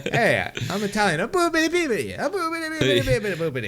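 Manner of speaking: hammed-up fake Italian accent; over-the-top Italian accent, laughing; bad Italian accent. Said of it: "a really bad Italian accent".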